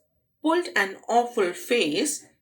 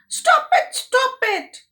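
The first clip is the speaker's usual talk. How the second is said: very high, loud panicked yell; yelled stop; 7 dB louder than usual. Acted. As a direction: squeal it upside down. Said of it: "yelled Lois".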